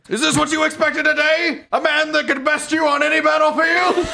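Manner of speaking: high, loud, boastful villain voice. Pretend. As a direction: taunt like a swaggering game boss. Voicing a game villain.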